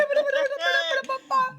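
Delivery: squealing burst of laughter; high laugh. Real, not acted. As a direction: shriek of laughter. Laughing at the name.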